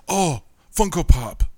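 soy face impression. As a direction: gush like an excited fanboy pointing at a screen. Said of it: "That was an incredible explanation".

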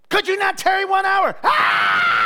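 high loud shouting wail; baby waking parents with a loud high scream; baby's angry wail. Retold or imitated. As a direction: wail like a woken infant. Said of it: "they're like".

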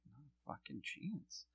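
muttered under the breath, very soft; slow, hushed refusal; mock whisper aside. Wife mutters to her husband walking away, very soft. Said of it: "she's basically like".

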